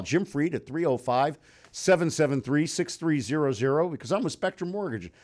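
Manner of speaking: ordinary delivery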